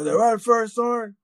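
high-pitched retold soldier voice; high, put-on army voice; imitated soldier. Retold exchange with first sergeant.

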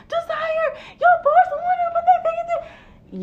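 very high mock wail, laughing; imitated frantic yelling. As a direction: mimic an upset friend's shrill holler. Imitates upset friend yelling back.

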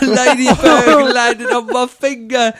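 high, loud, slow whine; hammed-up crybaby wail; mock wailing. Joining in mocking wimpy football players, loud drawn-out wail.

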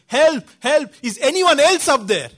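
high, loud calling upward; retold shout for help. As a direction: yell for any other rescuer, acting it out. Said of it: "he again shouted".

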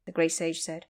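ordinary delivery, nothing marked

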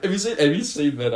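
usual speaking voice

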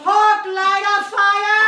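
high, slow, sung-out mock shout; blacksmith shout. Blacksmith calling to the mob, mock sung shout. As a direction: belt it like a hammy villager. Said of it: "The local blacksmith shouts".

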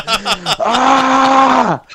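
high, short mock scream of pain. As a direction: yelp like a stung kid crying out. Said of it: "And I was going".

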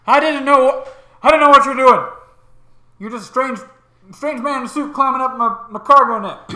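stern ship captain. Acted. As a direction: scold the stranger, startled. Captain answers Grimm.

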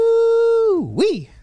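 very high, loud whooping cheer; excited whoop. Acted. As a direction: let out a hype whoop. Hyping the upcoming movie segment.

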